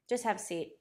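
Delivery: mock deadpan, no warmth at all; a stone-faced secretary saying sit down, deadpan; completely dead. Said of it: "Just completely dead".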